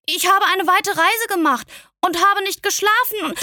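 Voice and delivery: put-on a tired child pleading his excuse; little prince explaining. A narrator's reading. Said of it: "der kleine Prinz ganz verwirrt".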